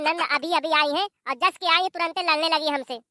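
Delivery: ordinary talk, nothing marked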